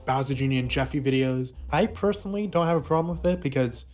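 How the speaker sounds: ordinary delivery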